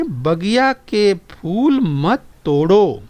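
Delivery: very slow, each word drawn out; slow, deliberate reading to the class; slow model reading. Shown as a demonstration. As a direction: read it out slowly for children to copy. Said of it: "आगे सुनिए और दोहराइए".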